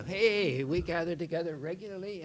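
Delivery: normal talking voice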